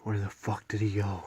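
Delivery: ordinary talk, nothing marked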